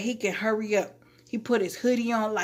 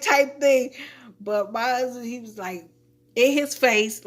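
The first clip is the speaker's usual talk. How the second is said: usual speaking voice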